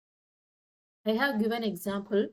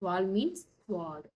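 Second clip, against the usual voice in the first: ordinary talk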